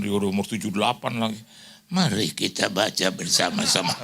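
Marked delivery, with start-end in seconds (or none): 1.9-3.9 low, drawn-out congregational call; slow-reading pastor impression. Pastor invites congregation to read together, slow imitated delivery. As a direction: mimic a plodding old-time preacher. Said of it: "suruh baca pelan-pelan".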